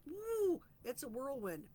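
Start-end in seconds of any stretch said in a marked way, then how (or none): 0.1-0.6 high, loud whoop; exhilarated little yelp; woo. Real, not acted. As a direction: toss off a quick whoop at the whirlwind. Time flying by.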